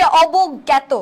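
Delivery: অবজ্ঞাত as "obog-gyæto", with doubled g; correct pronunciation. Shown as a demonstration. Teacher giving the correct answer, অবজ্ঞাত pronounced "obog-gyæto". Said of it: "সঠিক উচ্চারণ হচ্ছে".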